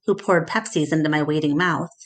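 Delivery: plain reading voice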